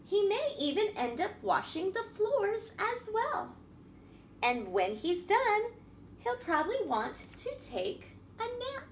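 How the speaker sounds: lively picture-book reading voice; reading to children. A narrator's reading. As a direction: read it out to a room of little kids.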